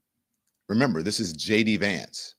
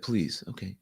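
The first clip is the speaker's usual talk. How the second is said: calm quiet voice; 3 semitones lower, 7 dB quieter than usual. Retold or imitated. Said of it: "He's very, very calm".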